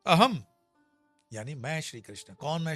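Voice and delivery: usual speaking voice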